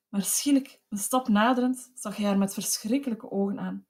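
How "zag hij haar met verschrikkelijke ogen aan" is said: usual speaking voice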